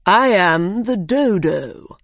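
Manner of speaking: slow, loud, pompous bird; dodo voice. A narrator's reading. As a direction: drawl it out grandly. Dodo character voice.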